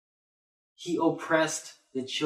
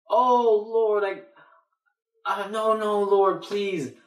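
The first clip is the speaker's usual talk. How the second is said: crying out to the Lord; 6 semitones higher than usual. Acted. Sick sinner begging the Lord again, acted crying out. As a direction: wail a mock prayer in panic. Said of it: "I'm crying out to the Lord again".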